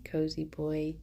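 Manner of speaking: hushed fond murmur to the dog; soft doting. Real, not acted. Owner talking to her dog Jerry, soft and doting. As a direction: coo softly at a sleepy pet.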